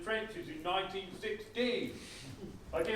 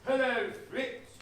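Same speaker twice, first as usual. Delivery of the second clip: knowing greeting; 5 semitones higher, slower than usual. Retold or imitated. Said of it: "and I go".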